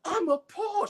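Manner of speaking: high, prissy mock outrage; pearl-clutching prude. Girlfriend shocked by the women, prissy and high. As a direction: play her scandalized, way up high. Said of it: "She's clutching her pearl".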